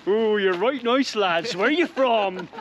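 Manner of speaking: drawn-out cafe-lady gush in a mock regional accent; Barbara. Cafe owner chatting up visiting lads, hammed-up local accent. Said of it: "that's the best accent I can come up with".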